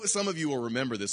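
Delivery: ordinary talk, nothing marked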